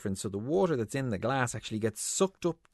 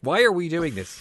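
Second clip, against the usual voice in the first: ordinary delivery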